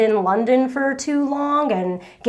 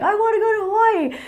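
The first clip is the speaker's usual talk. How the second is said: quick, much higher blurted wish; eager wish; 10 semitones higher, faster than usual. Retold or imitated. Vlogger recalling her reaction to a fan's question, high and eager. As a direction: squeal it like a sudden craving.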